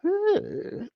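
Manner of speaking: very high gossipy ooh; delighted ooh. Real, not acted. Streamer hearing juicy gossip from a viewer, high ooh. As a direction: savour the juicy news.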